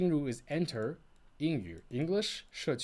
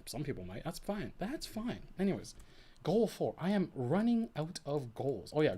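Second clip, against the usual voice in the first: ordinary talk, nothing marked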